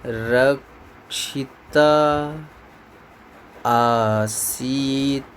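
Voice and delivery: रक्षिता आसीत् drawn out very slowly and low; slow dictation. Shown as a demonstration. Teacher dictating the last words to students, very slow. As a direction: read it out bit by bit for copying. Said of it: "भी copy कर दो".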